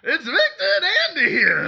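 loud high cowboy shout; cowboy announcer. Pretend. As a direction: holler like a rodeo host.

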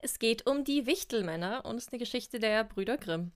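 plain reading voice

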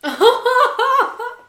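loud high embarrassed laugh; a sudden loud giggle at the question; burst of laughter. Real, not acted. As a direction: burst out laughing, caught off guard. Fantasy question makes her laugh.

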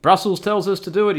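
high, loud mock official; sarcastic. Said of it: "The best sarcastic voice I can possibly do".

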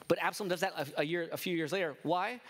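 usual speaking voice

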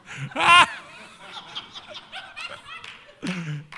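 loud, high burst of real laughter; preacher cracks up at his snake joke, real laughter; high laughing burst. Real, not acted.

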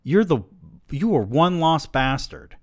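high, loud exasperated scolding. Acted. Man berating his lost friend by phone, exasperated.

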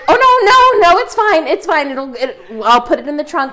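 high, loud panicky backpedalling; flustered driver. Retold or imitated. Cab driver backing down to a crying passenger, high and flustered. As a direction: imitate the driver caving in fast. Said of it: "And he was like".

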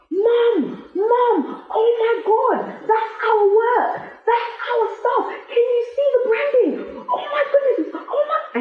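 imitating a thrilled little girl shouting to her mum; high delighted child's squeal with laughter; excited child scream. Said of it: "And she screams".

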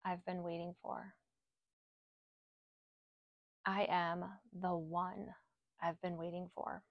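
soft, unhurried meditation voice with long pauses; guided affirmations. Acted. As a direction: lead them gently through each affirmation. Leads spoken affirmations.

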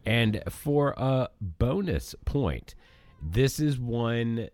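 a touch of game show host; quiz host. Acted. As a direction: announce the answer as right.

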